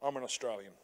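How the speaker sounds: a mild, dry no-thank-you at the door; polite mock refusal. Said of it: "Just go".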